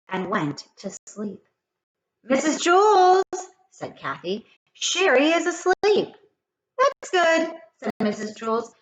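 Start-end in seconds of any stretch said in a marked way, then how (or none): 2.2-3.2 put-on high, loud little-girl call; tattling child. Kathy calling teacher to tattle on Sherry. 4.7-6.1 put-on tattling child. Kathy calling teacher to tattle on Sherry. 6.8-7.5 higher, bright pleased teacher voice; cheerful teacher. Acted. Teacher unbothered.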